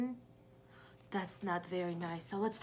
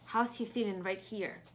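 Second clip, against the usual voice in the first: usual speaking voice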